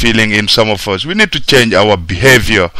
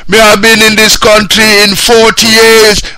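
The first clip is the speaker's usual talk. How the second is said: imitated Jamaican accent, pitched much higher; mock a Jamaican man boasting in his accent; 10 semitones higher, 4 dB louder than usual. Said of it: "some stupid Jamaican guy came around".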